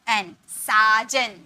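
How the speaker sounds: साजन spelled out very slowly, आ stretched; slow spelled-out word. Shown as a demonstration. Teacher slowly spelling साजन for students, stretched. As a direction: draw out साजन letter by letter. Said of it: "या ए का sound आ रहा".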